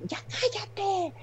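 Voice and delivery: high-pitched mock scolding, drawn out; yelling nurse. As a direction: imitate a nurse shrieking orders at patients. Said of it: "oyes a las enfermeras de".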